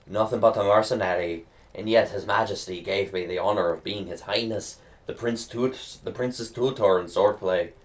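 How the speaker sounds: lightly voiced Scottish mentor with a bad accent; terrible Scottish accent. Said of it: "There's my terrible Scottish accent".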